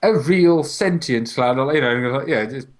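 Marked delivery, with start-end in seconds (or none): 0.0-1.6 mock documentary.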